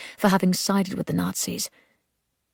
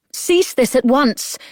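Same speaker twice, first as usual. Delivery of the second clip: slow, much higher and louder order; commanding shout; 7 semitones higher, 6 dB louder, slower than usual. A narrator's reading. Grace ordering the looters to stop, shouting. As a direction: bellow it at the whole mob. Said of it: "she shouted into the night".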